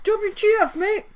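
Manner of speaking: high, loud Australian outburst; mock-angry Australian accent. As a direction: shout it like an outraged Australian, mate. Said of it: "when did you become Australian".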